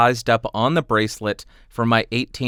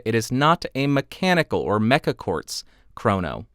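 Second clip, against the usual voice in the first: ordinary talk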